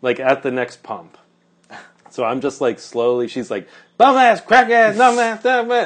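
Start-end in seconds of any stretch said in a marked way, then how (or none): 4.0-5.9 high, loud string of insults; imitated angry screaming. Woman screaming after the narrator as they get in the car. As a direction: keep yelling insults on one high pitch. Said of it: "like, screaming".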